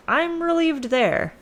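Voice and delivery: high, drawn-out sigh of relief in words; mock relief. Oedipus hears he avoided the prophecy, mock relieved. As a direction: pitch way up, slowly, play-acting relief.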